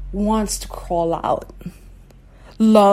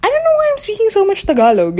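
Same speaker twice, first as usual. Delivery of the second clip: high, fast, startled blurt; mock surprise at herself, higher and quicker; surprised exclaim; 14 semitones higher, 5 dB louder, much faster than usual. Recalling a session with a client, surprised at herself, high and fast. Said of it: "I'd be like".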